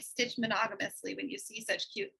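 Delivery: normal talking voice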